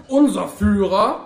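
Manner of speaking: slow, loud, high proclamation; declamatory. A narrator's reading. Wounded fascist starts praising his leader, loudly declamatory. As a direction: begin it like a rally speech.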